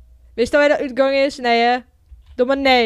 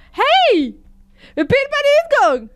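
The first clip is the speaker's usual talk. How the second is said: high, loud yell of discovery; excited shout; 12 semitones higher, 4 dB louder than usual. Real, not acted. Player tells Fan they reached the entrance, excited shouting. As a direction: cry out as you suddenly spot it.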